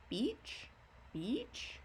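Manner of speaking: beach with a rising question tune. Shown as a demonstration. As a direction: ask beach, voice going up. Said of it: "Your voice goes up".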